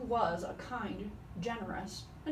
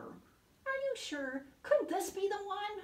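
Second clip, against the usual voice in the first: whining; 11 semitones higher than usual. A narrator's reading. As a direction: whine like a tired little child. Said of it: "whined Koala".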